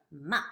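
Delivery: "ma" short, sharp fall; fourth tone. Shown as a demonstration. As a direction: throw "ma" downward in one short drop. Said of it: "Fourth tone".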